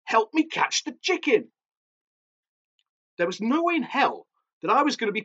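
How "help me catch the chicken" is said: high, frantic plea for help; high-pitched, urgent appeal; urgent panting. A narrator's reading. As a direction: call for help in a fluster. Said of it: "she panted".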